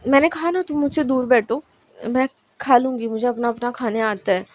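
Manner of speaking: ordinary delivery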